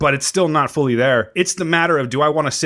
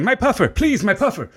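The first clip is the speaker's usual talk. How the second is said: higher laughing plea for the inhaler; mock asthmatic; 9 semitones higher than usual. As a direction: beg for the puffer half laughing. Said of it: "I just walk around going".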